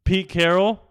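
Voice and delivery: name called out high and loud. Real, not acted. Fan announcing the coach he'll yell at, loud. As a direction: announce him like a target. Announcing next target of rant.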